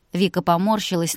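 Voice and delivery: plain reading voice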